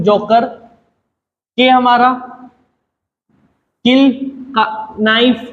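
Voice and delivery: ordinary talk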